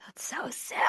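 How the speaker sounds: soft excited whisper. Real, not acted. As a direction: breathe it out in awe. Whispered reaction to the due date.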